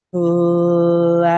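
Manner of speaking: Flag stretched out very slowly, each sound held long; slow motion. Shown as a demonstration. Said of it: "let's do it in slow motion".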